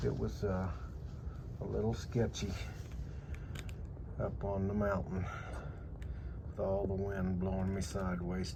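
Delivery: hushed late-night talk in a tent; quietly, so as not to wake anyone; whispering. Real, not acted. Whispering in sleeping bag at night.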